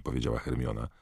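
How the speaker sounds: plain reading voice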